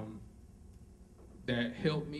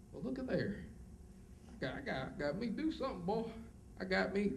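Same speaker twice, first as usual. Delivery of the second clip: country talk; 7 semitones higher, 7 dB quieter than usual. Retold or imitated. Imitating hometown folks he grew up with, amused country voice. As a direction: slip into how folks back home talk. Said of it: "that's how they talk".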